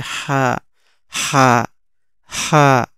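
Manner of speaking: "হা" from mid-throat, held one beat; one-beat stretch. Shown as a demonstration. Teacher drills the letter, throaty "হা" drawn one beat. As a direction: press and briefly stretch "হা". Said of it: "এক আলিফ টেনে উচ্চারণ হবে".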